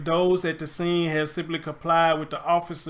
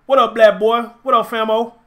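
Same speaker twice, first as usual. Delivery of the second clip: loud greeting; 3 semitones higher, 8 dB louder than usual. Real, not acted. Greeting viewers joining live.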